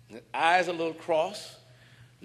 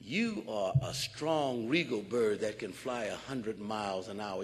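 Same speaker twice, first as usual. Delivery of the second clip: tender coaxing; 8 semitones lower, 5 dB quieter than usual. Retold or imitated. The man softly coaxing the bird to believe. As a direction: gently build the bird up.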